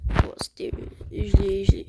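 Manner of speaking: whispered to self; hushed whisper mid-game. Real, not acted. Whispering during play.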